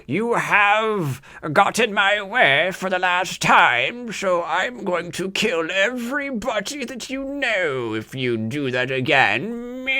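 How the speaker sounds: slow, high, hammy villain threat; mustache twirling villain. Retold or imitated. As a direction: ham up a cartoon bad guy. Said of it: "comic book style villain ... mustache twirling".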